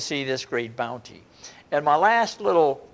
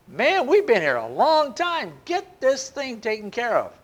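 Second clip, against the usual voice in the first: higher, impatient grumble; complaining; 7 semitones higher than usual. Retold or imitated. Martyrs complaining to God about the wait, impatient. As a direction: whine about waiting so long. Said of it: "sounds like they're leveling a complaint".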